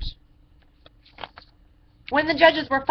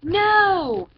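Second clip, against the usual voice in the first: loud high yell; 6 semitones higher, 5 dB louder than usual. A narrator's reading. Matthew shouting no to his dad. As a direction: shout it out like an eager boy. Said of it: "yelled Matthew".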